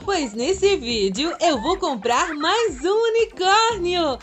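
put-on high, chirpy kids' channel greeting; sing-song cheerful hostess voice; bright squeaky sing-song welcome. Kids channel cheery intro.

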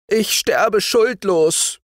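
hohe, jammernde Stimme des Verurteilten; klagender junger Seemann. Acted. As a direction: verzweifelt klagen. Seemann beteuert. (der Verurteilte jammert vor dem Kapitän, hohe klagende Stimme)

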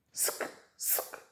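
whispered "Ск, ск" with no vowel. Shown as a demonstration. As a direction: hiss out bare "Ск". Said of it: "Спробуємо сказати без голосного". ("Ск" пошепки без голосного)

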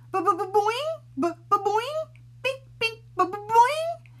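high loud bouncing boings, bink bink; stuttering boings. Acted. Reader voicing the hair bursting out everywhere. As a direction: rattle off springy cartoon noises.

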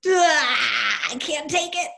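high mock cry of being fed up; a staffer's hammed-up cry of defeat; fed-up employee. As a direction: play a worn-out worker finally cracking. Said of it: "they go".

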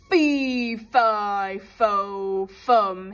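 loud booming giant chant; giant shouting. Acted. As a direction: roar it out like a giant. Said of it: "he shouted".

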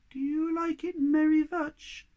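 very high squeaky little creature; tiny creature voice. Acted. Thingamy greeting Moomin Troll, high and squeaky. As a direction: squeak it like a tiny odd being.

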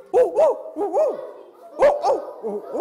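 loud, high hoots with a long falling last note; barred owl hoot. Shown as a demonstration. Handler demonstrating the barred owl's crazy call, loud high hooting. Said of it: "guys with the really crazy sounds".

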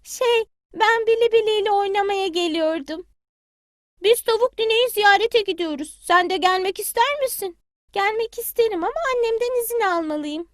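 high, eager little chick; a small child calling at granny's door. Acted. Bili Bili greets granny hen at her door, high child voice.

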